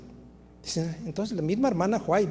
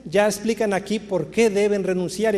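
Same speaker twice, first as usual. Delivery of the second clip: ordinary talk, nothing marked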